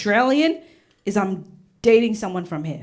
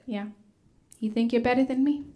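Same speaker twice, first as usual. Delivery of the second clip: ordinary delivery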